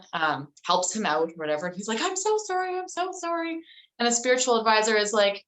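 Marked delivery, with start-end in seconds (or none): 2.0-3.6 much higher, pleading and contrite; pleading apology. Retold or imitated. King begging his advisor's pardon, high pleading voice. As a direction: beg forgiveness, voice up high.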